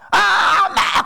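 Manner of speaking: high, loud holler for mom; child's yell. Acted. Preacher imitating a child calling mother to run over, shouting. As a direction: shout it like a child summoning a parent. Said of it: "막 소리 지르면".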